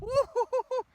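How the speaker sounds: high, loud string of whoops; excited whooping. Real, not acted. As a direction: whoop it up mid-game. Cheering during ball play.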